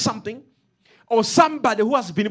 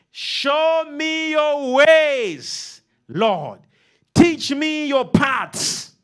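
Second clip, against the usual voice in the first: fervent psalm reading; 5 semitones higher, much slower than usual. Acted.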